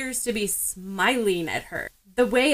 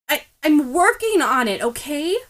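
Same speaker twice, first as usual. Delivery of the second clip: retorting; 5 semitones higher, 4 dB louder than usual. A narrator's reading. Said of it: "Ochako retorts".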